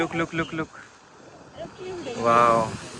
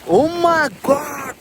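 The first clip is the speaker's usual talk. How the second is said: higher, slow, drawn-out gasp of awe; awed oh my god; 11 semitones higher, 6 dB louder, much slower than usual. Real, not acted. Seeing the drop below, slow awed exclaim. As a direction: stretch it out in wonder.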